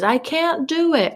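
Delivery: put-on high, drawn-out toddler voice, words said normally; imitating toddler. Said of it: "when she says".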